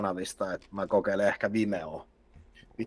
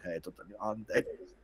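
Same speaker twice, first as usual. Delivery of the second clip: meek apology; 11 dB quieter than usual. Retold or imitated. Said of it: "ja kaikki".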